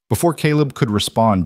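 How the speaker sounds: ordinary delivery, nothing marked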